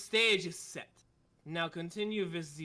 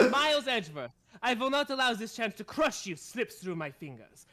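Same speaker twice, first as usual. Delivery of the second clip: put-on louder, confident German-accented threat; German accent, full flow; 4 semitones higher, 3 dB louder than usual. Von Karma threatening Edgeworth, confident German accent. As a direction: nail the accent in one sweep. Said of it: "There we go".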